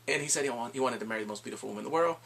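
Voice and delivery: normal talking voice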